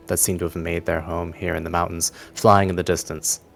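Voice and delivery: ordinary delivery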